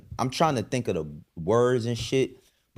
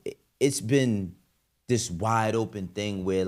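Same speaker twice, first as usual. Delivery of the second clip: ordinary delivery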